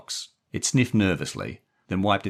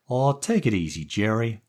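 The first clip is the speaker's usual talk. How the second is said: easygoing moll; 6 semitones higher than usual. A narrator's reading. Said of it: "the woman Daphne said, smiling at Stevie".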